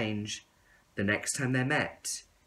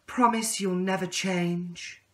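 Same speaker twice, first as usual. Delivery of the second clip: higher, slow, earnest plea; caterpillar pleading; 8 semitones higher, 3 dB louder than usual. Acted. Caterpillar begging the tadpole never to change, slow and high. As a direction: ask him to promise, slowly and tenderly. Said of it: "he said".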